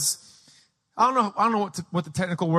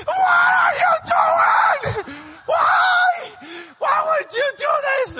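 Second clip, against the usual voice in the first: very high, loud drawn-out shriek; hammed-up panicked mother screeching; hysterical scolding; 4 dB louder, slower than usual. Mom scolding children from the window, hysterical scolding.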